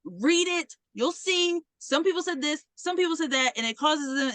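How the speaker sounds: high-pitched, unrelenting argument; stubborn insistence. Retold or imitated. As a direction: imitate a student refusing to be dismissed. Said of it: "she's not gonna stop talking to you".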